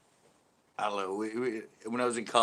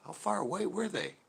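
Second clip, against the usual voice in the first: higher-pitched baffled question; mock bewilderment; 10 semitones higher than usual. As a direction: wonder aloud in puzzled amazement. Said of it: "And I'm like".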